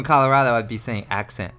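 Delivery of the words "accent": ACCENT with the final T left off; dropped T. Shown as a demonstration. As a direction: say ACCENT as "accen", Colorado style. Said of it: "just dropping it".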